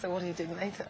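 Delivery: soft, low chat-up murmur; low flirty line. Shown as a demonstration. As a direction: quietly try a chat-up line, low and casual. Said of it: "God, that worked, didn't it?".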